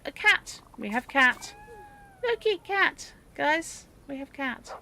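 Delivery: high-pitched, thrilled announcement of a cat; real excitement. Real, not acted. Streamer interrupts himself to show guys a cat, excited. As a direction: burst out high with sudden delight.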